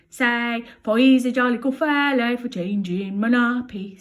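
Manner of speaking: coaxing Bill. Acted. Coaxing baby.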